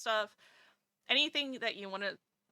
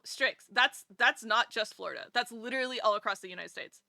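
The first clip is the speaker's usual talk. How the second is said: ordinary talk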